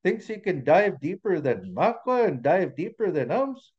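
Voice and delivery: high, sing-song storybook ridicule; teasing crowd voice, incredulous; mocking amusement. A narrator's reading. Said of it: "started laughing to themselves and pointing".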